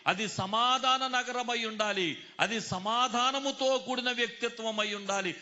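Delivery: high, loud preaching building to a climax; full-force preaching. Real, not acted. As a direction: proclaim it with mounting force, key words stretched. (ఆవేశంగా, ఎలుగెత్తి ప్రసంగం)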